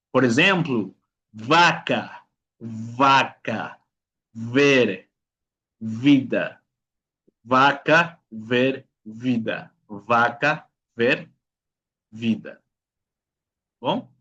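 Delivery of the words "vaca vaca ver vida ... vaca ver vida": "vaca, ver, vida" with buzzed V, upper teeth on lip; labiodental V. Shown as a demonstration. Teacher modelling for students, "vaca, ver, vida" with labiodental V. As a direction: drill "vaca, ver, vida" word by word, V never B. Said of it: "dientes superiores sobre el labio inferior".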